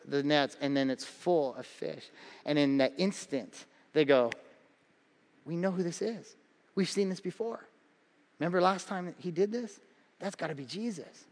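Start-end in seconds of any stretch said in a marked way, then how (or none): 5.5-11.3 much softer, awed realization; hushed awe. Retold or imitated. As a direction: whisper it to each other in wonder.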